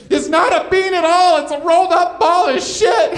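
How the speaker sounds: put-on high, loud old lady heckle shouted from the back; a sweet eighty-year-old heckler screaming from the back of the club; old woman yelling. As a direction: yell it out like a sweet old lady gone rogue. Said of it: "she yelled out".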